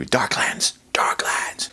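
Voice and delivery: voiceless stage whisper; ominous whisper. Acted. Ominous echo after door closes.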